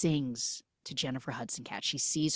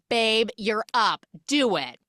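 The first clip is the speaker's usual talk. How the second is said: loud, raised, slow push to perform; mock urging; 6 semitones higher, 6 dB louder than usual. Victoria calls up her magic boyfriend, loud mock urging. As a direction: egg on a partner, hammed-up.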